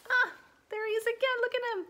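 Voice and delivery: very high, loud gushing over something cute; high squealing delight at a cute picture. Real, not acted. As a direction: coo over the tiny wetsuit.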